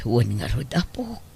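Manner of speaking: whisper. Acted. Grandmother speaks under her breath.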